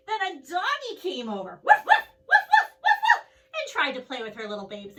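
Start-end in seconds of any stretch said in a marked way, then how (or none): 1.6-3.2 high, loud puppy yaps; yappy barking. Acted. Teacher voicing the dog that wants to play, loud high yaps. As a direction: bark like an excited little dog.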